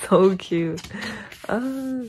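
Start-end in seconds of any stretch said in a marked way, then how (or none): none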